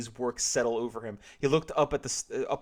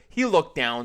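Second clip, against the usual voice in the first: matter-of-fact; 2 semitones higher, 7 dB louder, slower than usual. Acted. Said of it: "said Peggy matter-of-factly".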